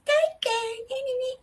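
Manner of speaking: put-on very high, sugary little-girl voice; baby voice. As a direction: coo your thanks like a toddler. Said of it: "she turns to this baby".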